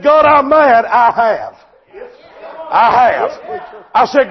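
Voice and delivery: loud, driving sermon delivery; full-force preaching. Real, not acted. Preacher to congregation on troubles and the tied colt, full-force preaching. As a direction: preach it hard, punching key phrases.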